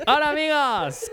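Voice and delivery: high slow dubbed voice; dubbed sitcom voice. Retold or imitated. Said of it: "que dice algo así".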